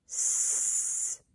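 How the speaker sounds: "S-E" split into its two sounds, hissed s then e; stretched sounds. Shown as a demonstration. Teacher stretches see into separate sounds. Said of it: "stretch and shrink see".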